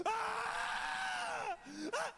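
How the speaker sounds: high, piercing acted shriek; mock scream. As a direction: scream like a man jolted back to life. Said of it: "stop screaming, for fuck's sake".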